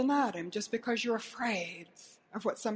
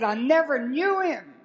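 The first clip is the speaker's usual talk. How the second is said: loud, drawn-out angry denial; cursing denial; 4 semitones higher, 9 dB louder than usual. Retold or imitated. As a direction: slowly shout your denial, pitch raised. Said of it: "And Peter cursed".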